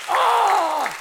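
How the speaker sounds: high excited shout of joy; excited cry. Real, not acted. Preacher caught up in praise, excited cry. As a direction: let out a joyful "oh". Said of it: "I just get excited".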